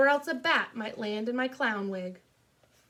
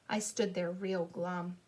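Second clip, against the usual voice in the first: glum; 4 semitones lower, 5 dB quieter than usual. A narrator's reading. Narrator deflated after failed scare.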